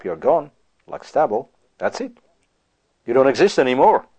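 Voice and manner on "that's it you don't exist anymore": usual speaking voice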